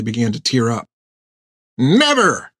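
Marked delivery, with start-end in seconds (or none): 1.8-2.5 cried out. Acted. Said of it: "cried Hank".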